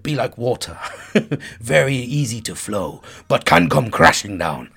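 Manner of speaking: low, put-on accent of a martial arts master; Bruce Lee accent. Friend's quote of a martial arts master, retold in a put-on accent. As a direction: quote it in a lightly mocked-up foreign accent. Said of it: "I don't know why I spoke in that accent".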